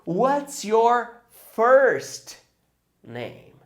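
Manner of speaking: drawn-out, very slow question; slow demo. Shown as a demonstration. Teacher demonstrates the question to the class, very slow. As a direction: spell the question out slowly for learners. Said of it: "You answer".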